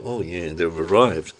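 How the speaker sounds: ordinary talk, nothing marked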